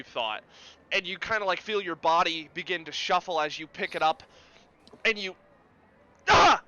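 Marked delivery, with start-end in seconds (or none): 6.3-6.6 short, loud exertion "ah"; effort grunt. Acted. Player slamming a heavy sword down, loud effort grunt. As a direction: grunt as if swinging something heavy.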